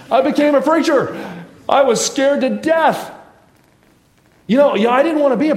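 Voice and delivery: high, loud comic outburst; loud exclamation. Real, not acted. Preacher reveals his own fate to the congregation, exclaiming.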